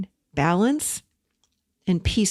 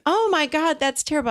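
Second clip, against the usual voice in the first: "Oh my God" jumps higher in mock alarm; mock shocked reaction; 8 semitones higher than usual. Retelling how people react to the dented car, mock shocked. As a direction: imitate strangers gasping at the news.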